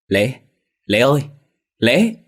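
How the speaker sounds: rising desperate calls of a name; calling loudly. A narrator's reading. Mother calling her lost son loudly. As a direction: call out loud into the bamboo grove. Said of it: "mà gọi lớn".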